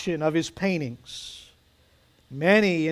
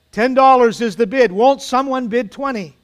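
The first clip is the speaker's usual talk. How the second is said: high, loud bid-calling; auctioneer's call; 6 semitones higher, 7 dB louder than usual. A narrator's reading. Auctioneer presses for another bid.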